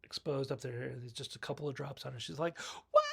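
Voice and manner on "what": very high squealed mock disbelief; mock shocked shriek. Podcaster imitating the victim seeing blood drops, mock shriek. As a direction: squeal it like a startled movie victim.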